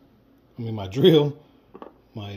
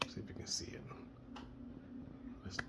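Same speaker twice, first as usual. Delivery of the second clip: whispering; 20 dB quieter, faster than usual. Real, not acted. Muttering to himself while working.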